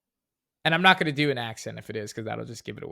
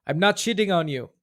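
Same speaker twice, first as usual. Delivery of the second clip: put-on higher-pitched line with an Arnold-style Austrian accent; Arnold's Austrian accent; 6 semitones higher, 3 dB louder than usual. Reader accidentally gives away the movie quote, in Arnold's Austrian accent. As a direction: slip into a light Austrian accent. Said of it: "you said it with the accent".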